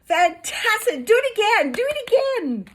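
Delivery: high, loud excited shout. Acted. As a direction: cheer the dragon on at full voice. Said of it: "Elizabeth shouted".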